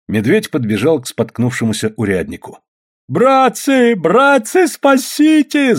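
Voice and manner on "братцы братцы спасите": very high, drawn-out cry for help; desperate yell. A narrator's reading. Попов begging the peasants for help, desperate high yell. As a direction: scream for rescue, stretching the last word. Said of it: "заорал Попов".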